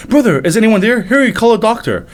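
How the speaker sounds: high urgent cry for help; panicked call. Acted. Royal calls for help over sick brother, urgent high cry. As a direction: call out frantically. Panic as prince collapses again.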